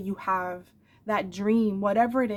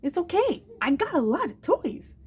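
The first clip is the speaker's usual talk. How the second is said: put-on high, sweet small-child voice; little boy voice; 9 semitones higher than usual. Mother quoting her little son's reassurance, childlike and high. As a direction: lightly play her son as a little boy. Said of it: "He would tell me".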